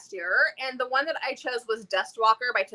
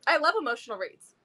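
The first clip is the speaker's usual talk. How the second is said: ordinary delivery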